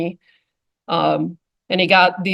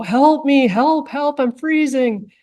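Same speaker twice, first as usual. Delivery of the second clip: higher, whiny cries of "help"; imitating a pleading suspect; 6 semitones higher, slower than usual. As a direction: ham up a cold man begging for help. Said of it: "this suspect is yelling".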